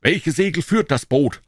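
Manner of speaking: usual speaking voice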